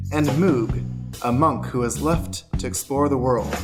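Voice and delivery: ordinary talk, nothing marked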